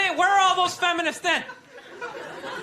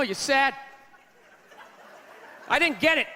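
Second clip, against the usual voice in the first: ordinary talk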